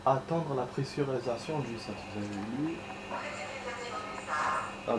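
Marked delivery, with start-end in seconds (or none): none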